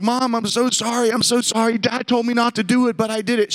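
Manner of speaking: put-on high-pitched, panicked kid apologizing; retold crying apology. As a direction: plead with mom, pitch pushed up, no real sobs. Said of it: "I'm screaming, I'm crying".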